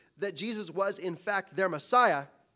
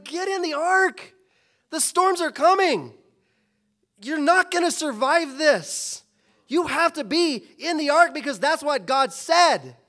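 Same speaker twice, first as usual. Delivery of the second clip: high and loud, pleading with them to get in; urgent warning shout; 8 semitones higher, 8 dB louder than usual. Retold or imitated. Noah shouting at the mocking crowd to get in the ark, urgent loud warning. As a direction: shout the warning like a desperate prophet.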